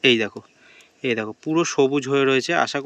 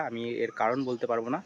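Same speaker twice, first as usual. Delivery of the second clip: usual speaking voice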